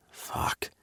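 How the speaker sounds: breathless whispered curse; breathy gasped oath. A narrator's reading. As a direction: gasp it under your breath. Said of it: "I gasped".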